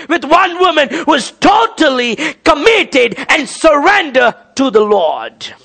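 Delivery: high, emphatic pulpit voice; full-force preaching. Real, not acted. Preacher urging total surrender to the Lord, full-force preaching. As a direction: drive each phrase at the congregation.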